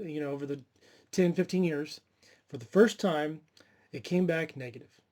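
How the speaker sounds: normal talking voice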